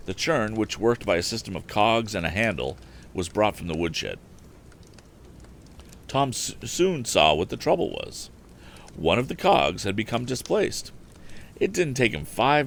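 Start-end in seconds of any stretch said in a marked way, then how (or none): none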